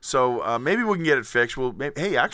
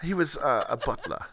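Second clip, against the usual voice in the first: put-on lightly held English accent, playful; posh English accent; 3 semitones higher than usual. Guest answering the co-hosts' questions in a light English accent. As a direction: keep up the mock English guest. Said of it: "with this accent".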